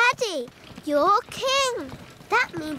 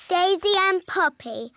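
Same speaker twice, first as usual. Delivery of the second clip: ordinary delivery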